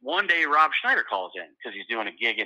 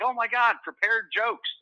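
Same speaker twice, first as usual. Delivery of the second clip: mock excited crowd; 10 semitones higher than usual. Audience voiced, retold.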